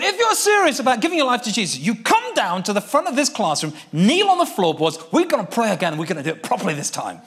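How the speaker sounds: rally them down to the front; commanding. Retold or imitated. Commanding boys to kneel.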